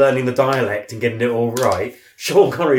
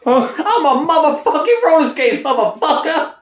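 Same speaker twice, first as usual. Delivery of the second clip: high squealed swearing Frank voice; mock Samuel L. Jackson as Frank; 3 dB louder than usual. Riffing Samuel L. Jackson as Frank Spencer, high squeal. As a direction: shriek it like a bumbling Frank.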